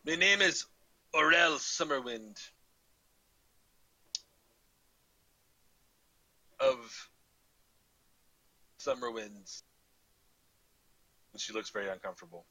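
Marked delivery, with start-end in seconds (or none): 0.0-9.6 halting introduction in a put-on Scottish burr; horrible Scottish accent. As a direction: give your name haltingly in mock Scottish. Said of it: "back to my horrible Scottish accent".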